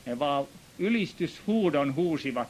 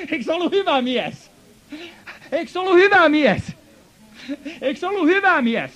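much higher and louder, building to a shout; rousing shout; 12 semitones higher, 11 dB louder than usual. Real, not acted. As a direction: whip up the congregation. Preacher rousing the congregation. (seurakuntaa innostava huuto)

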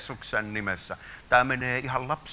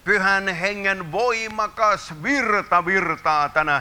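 high, loud and slow, voice strained; ecstatic proclamation; 9 semitones higher, 7 dB louder, slower than usual. Real, not acted. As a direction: declare it slowly at full force. Climax of the prayer. (hidas, korkea hurmoksellinen julistus)

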